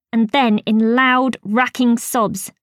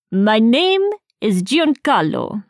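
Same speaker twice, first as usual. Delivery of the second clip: lightly voiced Italian accent; slower than usual. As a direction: say it with a gentle Italian lilt, slowly. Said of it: "he said in an Italian accent".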